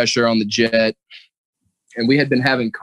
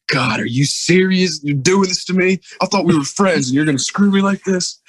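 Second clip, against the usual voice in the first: mock whiny complaint; 9 semitones higher than usual. Supervisor whining to the owner, hammed-up betrayed whine. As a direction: whine like a friend got stabbed in the back. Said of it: "that guy was like".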